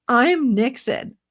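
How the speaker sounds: mocking a vice president's smug claim; J D Vance. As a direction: ham up a smug politician's boast. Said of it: "J D Vance is all like".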